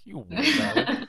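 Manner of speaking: high, drawn-out teasing jab; mock disbelief. Teasing friends who call every soda Coke, high mock disbelief. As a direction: stretch it out loud and high, half laughing.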